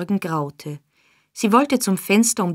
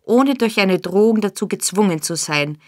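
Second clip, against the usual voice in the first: plain reading voice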